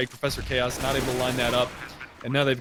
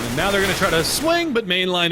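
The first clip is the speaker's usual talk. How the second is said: excited; 6 semitones higher, 6 dB louder than usual. Real, not acted. Play-by-play of a swing.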